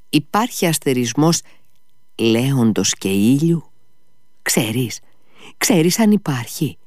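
high, feverish, soaring then whispering; delirious rambling. A narrator's reading. Ivan rambling at his brother in fever, high delirious voice.

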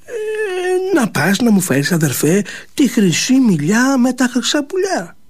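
lightly voiced plaintive girl; complaining sister. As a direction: sulk to your brother about being stuck inside. Sister pleads with brother.

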